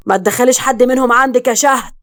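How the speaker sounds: loud, urgent called-out warning; shouting. A narrator's reading. Man being dragged off warns his wife, shouting. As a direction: shout it to carry back upstairs. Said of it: "صرخ بأعلى صوته".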